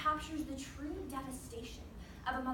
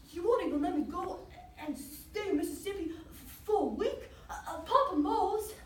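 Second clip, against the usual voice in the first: put-on higher boyish plea, catching on sounds; stuttering protest; 4 semitones higher than usual. Boy asking Mama for a week away, stuttering. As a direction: protest to mother, tripping on words. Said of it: "had a problem talking".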